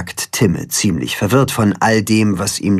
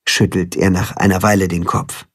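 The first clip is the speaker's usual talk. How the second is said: plain reading voice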